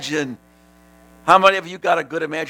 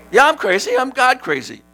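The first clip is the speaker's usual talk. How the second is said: excited; 11 semitones higher, 4 dB louder than usual. Real, not acted. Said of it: "Tony, you're crazy".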